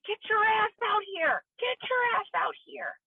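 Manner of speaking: retold mother's yell, pitched high; mother's screaming. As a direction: scream it down the hallway at your kid. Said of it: "my mom is screaming".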